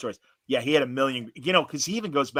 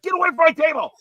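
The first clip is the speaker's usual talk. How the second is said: much higher, louder imitated yell; mock scream; 8 dB louder than usual. Twerpy guy screams at the speaker to leave, mock shriek. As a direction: shriek like an outraged little man. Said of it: "And he's screaming at me".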